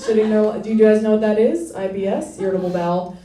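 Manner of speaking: ordinary talk, nothing marked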